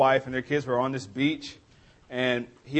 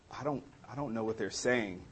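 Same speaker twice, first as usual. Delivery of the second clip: imitating a lost listener, much softer; baffled aside; 3 semitones lower, 13 dB quieter than usual. Campus minister confused by local students, retold as a quiet aside. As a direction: drop to a low, puzzled undertone.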